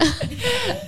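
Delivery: a sudden, very high burst of laughter. Real, not acted. Host laughing at co-host's very lost joke.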